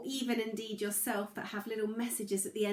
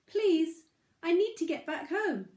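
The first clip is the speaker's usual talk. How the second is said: high, louder pleading pebble voice; pleading little pebble; 8 semitones higher, 6 dB louder than usual. Acted. Pebble begs the moose to help, high pleading voice. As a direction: beg like a small lost pebble. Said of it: "said the pebble".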